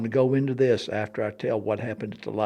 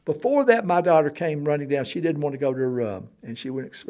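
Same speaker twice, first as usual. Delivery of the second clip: ordinary talk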